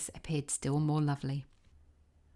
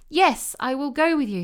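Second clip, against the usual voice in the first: eager reply; 9 semitones higher, 10 dB louder than usual. A narrator's reading. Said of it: "said Thumbelina".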